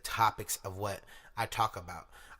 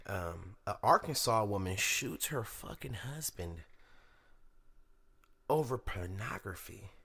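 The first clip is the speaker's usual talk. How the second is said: normal talking voice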